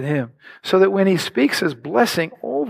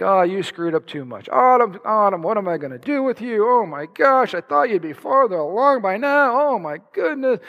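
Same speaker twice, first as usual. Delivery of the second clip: high, hammed-up exasperation; mock exasperated God; 4 semitones higher than usual. Preacher voicing a disappointed God scolding Autumn, mock exasperated. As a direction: imitate a disappointed parent at wit's end. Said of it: "God has this attitude of you".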